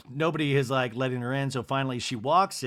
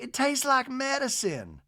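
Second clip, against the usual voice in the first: high, slow society matron; Miss Patricia; 10 semitones higher than usual. Retold or imitated. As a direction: imitate a grand hostess, drawn out and lilting. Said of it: "Miss Patricia's like".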